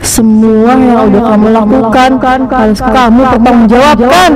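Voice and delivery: low menacing phantom voice ending in a laugh; haunting ghost. Acted. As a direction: threaten him like a vengeful spirit.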